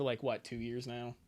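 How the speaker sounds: ordinary delivery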